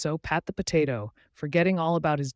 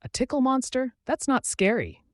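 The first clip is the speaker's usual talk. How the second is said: high, lightly amused disbelief; relieved and amused; 10 semitones higher, 4 dB louder than usual. A narrator's reading. Boy learns monster only tickles, relieved and amused. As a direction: scoff with relief in a raised voice. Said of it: "relieved and amused".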